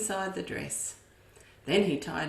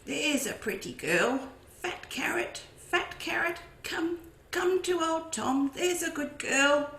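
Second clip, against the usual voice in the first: high sing-song coaxing; sweet-talking the cow toward the carrot; coaxing farmer; 9 semitones higher than usual. A narrator's reading. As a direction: coax the cow closer, sweetly.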